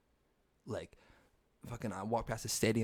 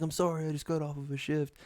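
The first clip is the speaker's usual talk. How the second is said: higher-pitched voice for an apologetic waitress; imitated waitress; 5 semitones higher than usual. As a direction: lightly mimic her apology, pitched up.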